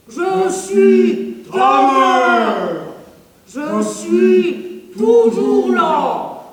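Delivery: slow sung ghostly mother's voice; drawn out, otherworldly and haunting; voice from beyond the grave. Acted. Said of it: "cette voix d'outre-tombe".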